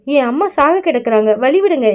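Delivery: ordinary delivery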